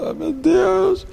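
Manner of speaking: high, slow, mock-despairing sigh of "Ah, meu Deus"; a hammed-up depressed diner groaning to himself.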